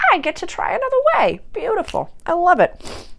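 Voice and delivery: bright high chirpy delight, fast; high delighted squeal. Retold or imitated. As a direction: squeal with delight at the new path. Acted cheerful self-talk.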